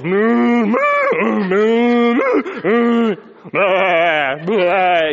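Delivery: loud, high mock moos and blahs; loud mooing animal noises; imitated cattle mooing. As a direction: moo like a panicked herd, loud. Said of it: "you hear all these animals".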